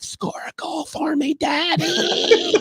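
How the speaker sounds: high, breathy cheer, mock infatuated; mock swooning falsetto. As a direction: squeal it like a starstruck fan. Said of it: "I'm like".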